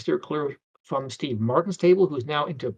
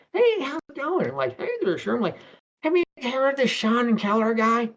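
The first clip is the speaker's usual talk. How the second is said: retold chit-chat; 9 semitones higher than usual. Retold grocery store encounter, put-on voices.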